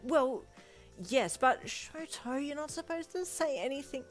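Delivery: high, loud flustered scolding; flustered protest. A narrator's reading. Scolding Shoto for telling friends, flustered. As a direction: protest in a panic at being exposed.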